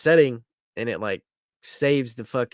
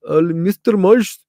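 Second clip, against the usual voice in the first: imitated agent; 4 semitones higher, 8 dB louder than usual. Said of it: "He was like".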